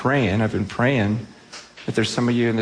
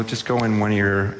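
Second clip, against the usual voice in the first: ordinary delivery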